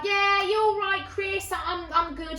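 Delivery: high singsong hello; over-friendly greeting. Retold or imitated. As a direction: greet an acquaintance with put-on enthusiasm. Over-bright greeting to acquaintance.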